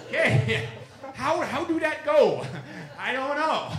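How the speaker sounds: high, loud laughter through the words; cracking up at the absurdity; laughing. Real, not acted. Speaker laughs to the audience at the English-speaking creatures, high and giggling.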